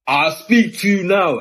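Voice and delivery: high, loud and drawn-out confrontation; threatening. Retold or imitated. Policeman coming right up to threaten him, loud threatening voice. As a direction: square up to them, stretching each word. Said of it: "to, like, threaten me".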